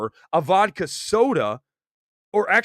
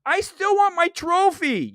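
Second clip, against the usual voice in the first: put-on whiny kid; 12 semitones higher than usual. Whiny kid demanding trophy.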